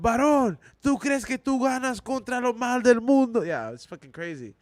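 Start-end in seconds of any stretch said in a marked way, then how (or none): 0.0-3.4 high, insistent mock sermon shout; yelling street preacher. Corner preacher challenging a man about evil, imitated yelling. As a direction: challenge the sinner in a raised preacher's cry. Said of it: "And they're yelling out".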